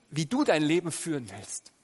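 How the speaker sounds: usual speaking voice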